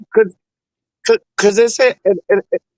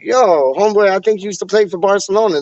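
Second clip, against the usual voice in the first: normal talking voice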